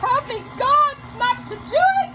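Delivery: high, loud cry for help; hammed-up plea to heaven; pleading with God not to lick her fingers; mock desperate plea.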